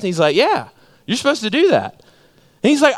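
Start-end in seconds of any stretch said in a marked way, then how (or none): none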